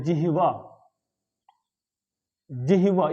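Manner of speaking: जिह्वा as "ji-hi-va"; जिह्वा ending in "va", not "ha"; correct pronunciation. Shown as a demonstration. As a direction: say जिह्वा with h before v. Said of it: "ये शब्द है".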